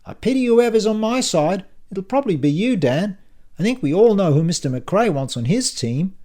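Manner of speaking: high, loud, running on; talkative Silvio. Acted. Silvio talks on as his glass is filled, high and loud. Narrator voices Silvio chattering.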